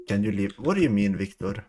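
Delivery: ordinary talk, nothing marked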